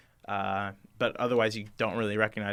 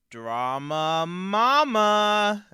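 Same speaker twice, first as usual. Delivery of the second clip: high, loud, long drawn-out mock reveal; hammed-up announcement; 9 semitones higher, 6 dB louder, much slower than usual. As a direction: stretch it out, rising theatrically. Mock soap reveal in outro.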